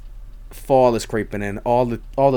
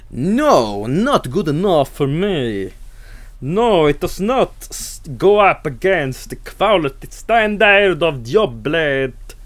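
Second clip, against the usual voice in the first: slow, louder, higher pompous snob voice; mock beer snob; 6 semitones higher, 4 dB louder, slower than usual. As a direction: puff up like a snooty connoisseur. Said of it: "I'm never like".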